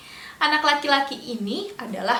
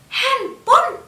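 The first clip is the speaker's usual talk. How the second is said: handphone. squeaked much higher and louder; mock surprise; 11 semitones higher, 6 dB louder than usual. Shown as a demonstration. Storyteller acts surprised to the children, handphone. jumps high.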